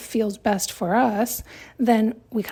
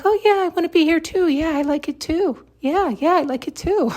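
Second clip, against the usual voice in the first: high, bright forced yes-man voice, higher; hammed-up fake cheer, pitched higher; fake agreement; 6 semitones higher than usual. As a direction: fake it, eager to please. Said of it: "can fake it and be like".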